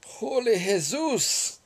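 high, slow, loud yelp of shock; startled cry. Real, not acted. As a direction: blurt it out, truly startled. Startled exclamation.

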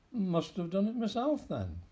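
usual speaking voice

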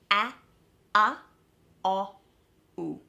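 the ow sound split into four separate vowels, "A, a, o, u"; separate vowel steps. Shown as a demonstration. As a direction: say "A, a, o, u" one at a time, with gaps. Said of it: "And that's the sound".

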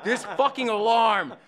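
ordinary talk

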